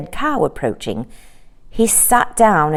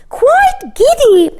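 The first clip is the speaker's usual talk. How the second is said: very high, slow astonished echo; echoing courtiers; 5 dB louder, slower than usual. A narrator's reading. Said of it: "echoed all the courtiers".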